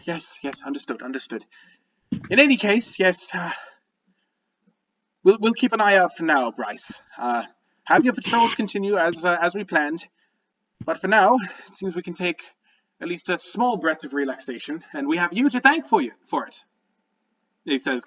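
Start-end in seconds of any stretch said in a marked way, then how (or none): 0.0-1.4 softly, after a deep breath; hushed, placating repetition; calming down. Acted. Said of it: "takes a deep breath, and he's just like". 2.3-16.6 lightly voiced, slightly raised official; a hint of a fussy town leader; composed gnome leader. Game master plays Starosta Kosh.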